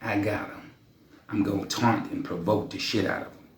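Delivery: soft, low promise to wife; hushed confident reply to wife; reassuring husband. Retold or imitated. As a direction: quietly tell the wife you've got it. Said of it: "I said".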